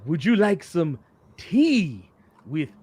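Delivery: slow, spooky vampire host; Dracula voice. Retold or imitated. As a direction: drag it out like a campy Dracula. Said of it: "dressed up as Dracula".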